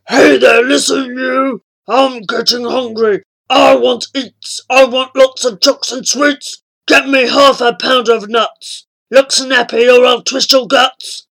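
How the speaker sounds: loud, rough demanding tummy beast; talking stomach. A narrator's reading. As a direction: bark orders from inside a belly. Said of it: "It shouted".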